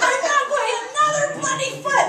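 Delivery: high, loud re-enacted outburst; vicious yelling. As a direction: act out a furious refusal yelled from up high. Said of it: "I'm yelling down at them like I'm vicious".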